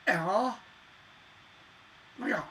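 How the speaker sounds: mock Valley Girl gasp; lightly voiced Valley Girl exclaiming; teen Valley Girl reacting, hammed up. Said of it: "as the Valley Girl will go".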